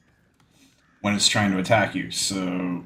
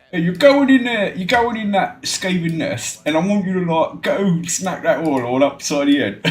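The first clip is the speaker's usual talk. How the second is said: high, loud attempt at a character's orders; half-remembered impression, pitched up; Morrow; 7 semitones higher, 4 dB louder than usual. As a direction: send a trooper off in a put-on voice. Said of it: "reminded of what Morrow sounds like".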